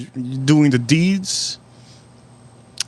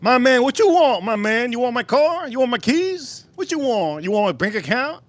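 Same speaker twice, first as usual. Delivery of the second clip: indignant retort; 10 semitones higher than usual. Retold or imitated. Vlogger retelling his retort to the homeless guy, high outraged. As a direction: fire back at him in exasperated disbelief. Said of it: "That's it?".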